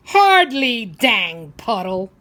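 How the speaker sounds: grumpy retort. Acted. Grumpy old man snapping at a cheerful boy, loud. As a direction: snap it back like a cranky old man.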